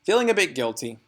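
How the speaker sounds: usual speaking voice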